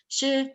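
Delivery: SHE high and flat, unmoving; high level tone. Shown as a demonstration. Teacher explaining the straight-line symbol, high level tone. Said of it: "dia tinggi tapi datar".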